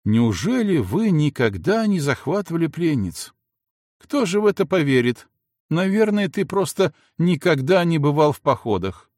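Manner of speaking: high, mocking young woman; teasing girl voice. A narrator's reading. Милана taunts Федька about the campaigns, high and mocking. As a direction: needle him, voice lifted high.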